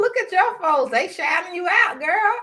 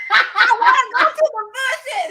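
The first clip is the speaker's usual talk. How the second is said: higher, drawn-out laughing delivery; 7 semitones higher, slower than usual. Real, not acted. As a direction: say it slowly through real laughter. Laughing along at own joke.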